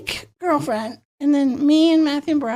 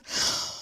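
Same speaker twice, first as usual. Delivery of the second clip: mock gasp; 8 dB quieter than usual. Actress gasps before her line.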